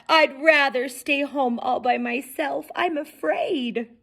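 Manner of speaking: put-on high, fretful boy king voice; timid little king. A narrator's reading. Frightened King Mo begging his mother to stay home, high and fretful. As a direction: whine out every fear like a scared child.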